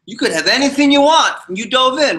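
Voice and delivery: high, loud, insistent question; pressing millionaire. Retold or imitated. Millionaire demanding what the swimmer wants, high and loud. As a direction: push him hard for the answer. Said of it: "You don't want money".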